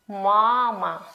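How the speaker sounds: MAma, first syllable stretched out; stress on first syllable. Shown as a demonstration. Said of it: "First syllable is a little bit longer".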